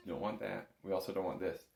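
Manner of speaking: ordinary delivery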